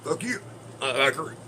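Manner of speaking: high-pitched mock movie-dub cussing; old Japanese movie dub. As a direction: curse like a badly dubbed old Japanese film. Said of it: "one of them old Japanese movies".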